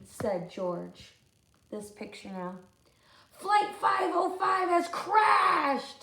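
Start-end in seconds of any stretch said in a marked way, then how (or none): 3.3-6.0 loud, high, slow alarm call; urgent shout. Acted. Luther announcing the crash, shouting slowly.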